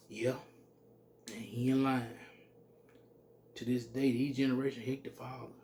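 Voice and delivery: normal talking voice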